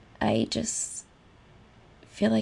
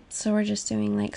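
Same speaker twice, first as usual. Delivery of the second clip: ordinary talk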